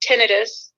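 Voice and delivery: "tinnitus" stressed on the first syllable, short vowels; short-i pronunciation. Shown as a demonstration. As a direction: say "tinnitus" as TIN-uh-tus. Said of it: "but it is tinnitus".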